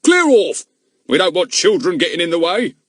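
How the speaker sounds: loud, high order to leave; yelling. A narrator's reading. Policeman yelling the boy away, loud. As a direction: bark it at the boy. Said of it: "he yelled".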